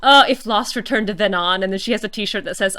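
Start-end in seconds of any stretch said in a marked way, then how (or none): none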